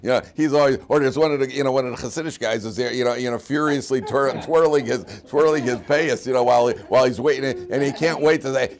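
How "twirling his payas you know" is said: ordinary talk, nothing marked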